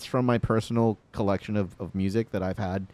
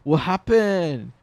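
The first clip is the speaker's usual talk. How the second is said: slow, higher, louder teasing; mocking roommates; 8 semitones higher, 6 dB louder, much slower than usual. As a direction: taunt like roommates laughing at the dead Zune. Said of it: "They're just like".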